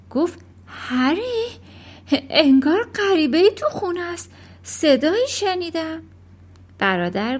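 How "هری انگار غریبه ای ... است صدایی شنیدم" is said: higher-pitched, a touch of fright; mildly startled, raised-pitch alarm; alarmed mother. A narrator's reading. Mother telling Harry a stranger is in the house, alarmed. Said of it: "مادر رنگش پرید".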